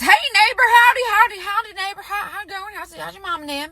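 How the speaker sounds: folksy neighbor greeting. Retold or imitated. Husband turning from wife to neighbor, high folksy greeting.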